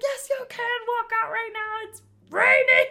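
high, insistent parent yell; retold urgent yelling. Parent urging scared child out into rain, urgent yelling. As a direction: holler it, peaking loud at the end.

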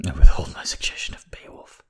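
dry whispered aside; hushed deadpan whisper. Real, not acted. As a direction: breathe it like a secret. Aside after reading a passage.

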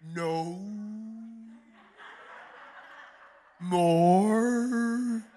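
a hint of a swaggering prophet with a laugh; mock Elijah decree. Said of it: "That's exactly how he said it".

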